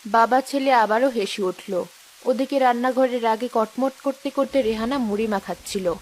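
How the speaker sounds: low, calm storyteller voice; story narration. A narrator's reading. Narrator sets the kitchen scene, low storytelling voice.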